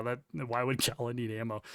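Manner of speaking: can't keep a straight face; laughing. Real, not acted. Amused by Gala needing ammo, laughing while talking. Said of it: "Sorry, I'm laughing".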